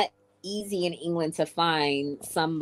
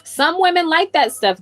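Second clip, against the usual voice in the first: loud exclaim; 11 semitones higher, 10 dB louder than usual. Real, not acted.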